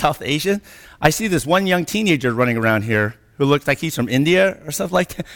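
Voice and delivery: ordinary talk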